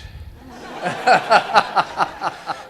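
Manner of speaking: short breathy laugh at his own joke; a quick amused chuckle; audience-prompted laugh. Real, not acted. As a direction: laugh it off lightly. Joke about broken English.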